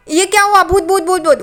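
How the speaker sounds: scared shout at the sight of a ghost; ghost panic. Retold or imitated. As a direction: jump up crying ghost. Tejas startled, thinks it's a ghost.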